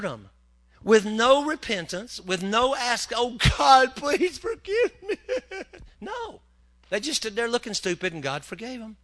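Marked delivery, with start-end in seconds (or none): 3.1-5.2 high, loud hammed-up cry for mercy; over-the-top penitent squeal; mock wailing plea. 5.2-6.0 short giggle; high amused giggle; high laugh. Real, not acted. Laughs after mock plea.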